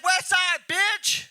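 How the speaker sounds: high, loud mock gang shout; put-on bravado. Comic retells talking shit to a rival, mock-tough shout. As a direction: holler it like a fake tough guy. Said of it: "I'm saying shit I ain't never said".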